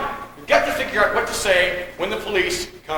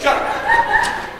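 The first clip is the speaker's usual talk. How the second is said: very high shriek of irritation; furious snap. Acted. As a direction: shriek it to silence the mockery. Mocked, snaps at him.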